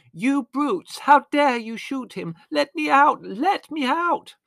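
very high, loud screaming; girl screaming behind a locked door; hysterical girl. A narrator's reading. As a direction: scream and beg to be let out.

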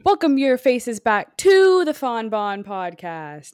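loud, drawn-out podcast welcome; showy host welcome, stretched out; hyped-up host intro. Acted. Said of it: "So plain".

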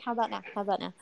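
quiet, repeated mock checking; mock self-quote. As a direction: softly parody yourself testing the mic. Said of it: "It's like".

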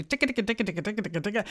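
rattled-off mock list of chores; kvetching. Woman retelling her kvetching about chores, rattled-off mock complaining. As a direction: chatter through an endless to-do list. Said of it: "kvetching about all the things I had to do".

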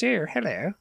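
put-on Queen's English accent. Showing the full Queen's English accent to a friend. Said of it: "like, the Queen's English".

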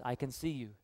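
a soft, low voice in a dark house; very quiet voice. A narrator's reading. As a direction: say it quietly, like someone hidden watching. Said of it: "the parrot looks at him in the eyes and says".

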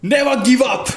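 high, loud anime hero shout; anime battle cry. Pretend. Anime intro chant.

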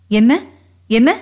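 taken aback, short rising question; startled question. Acted. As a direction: ask it in quick disbelief, pitch sweeping up. Kamali startled by a secret about her sister.

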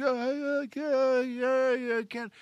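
high, thin mock whimper; acted tearful protest. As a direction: wail it weakly, dying away. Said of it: "she's whimpering".

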